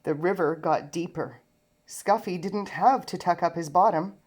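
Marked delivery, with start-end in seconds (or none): none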